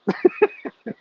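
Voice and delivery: high burst of laughter. Real, not acted. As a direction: laugh hard at the punchline. Laugh after the ticket punchline.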